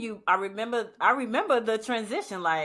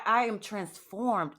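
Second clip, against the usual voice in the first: normal talking voice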